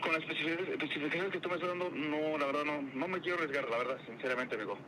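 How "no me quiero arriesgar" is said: usual speaking voice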